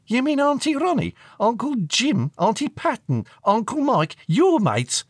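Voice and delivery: put-on high, loud teenage retort; scornful teenage daughter. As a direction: reel off the names dismissively at your parents.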